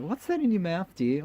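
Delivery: a playful high-pitched query to her husband; mock wife's question. Wife asks husband about the self-brushing toothbrush, high and mock-curious. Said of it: "I said".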